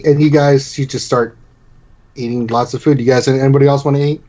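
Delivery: normal talking voice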